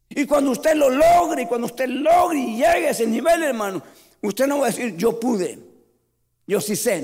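fiery sermon at full voice; full-force preaching. Acted. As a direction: preach it out to the whole church. Preaching to the congregation.

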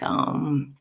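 ordinary delivery

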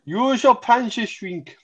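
put-on high, slow botched Russian accent; failed Russian accent. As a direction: drag out a clumsy Russian accent. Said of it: "What the heck was that?".

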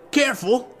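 put-on high-pitched kid's warning; cautioning. A narrator's reading. Gang kid warns the others about Link, cautioning. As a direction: warn the gang in a young boy's voice. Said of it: "one of the others cautioned".

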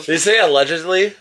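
distant call from the next room; shouted from another room. Real, not acted. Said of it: "Adam's yelling something from the other room".

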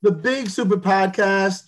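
high, showy host announcement; announcer voice. Acted. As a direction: hype up the show name.

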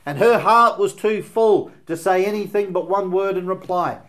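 loud, high, urgent pulpit voice; preaching at full force. Acted. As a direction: drive the question home to the congregation. Preacher builds to climax.

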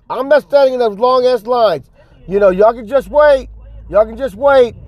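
high, insistent mock rant; high-pitched, stubborn customer voice; defiant announcement. Acting defiant guy in checkout line.

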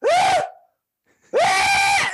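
very high, loud goat screams. Retold or imitated. Goat at the empty Doritos pantry, imitated screaming. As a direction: imitate a goat screaming full blast, twice. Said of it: "that was a really good goat scream".

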